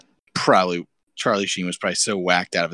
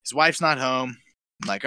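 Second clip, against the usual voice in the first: ordinary delivery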